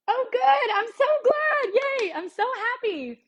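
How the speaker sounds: very high, gushing joy; delighted squeal. Real, not acted. Cook thrilled a viewer learned something, delighted squeal. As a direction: squeal happily, easing down by the end.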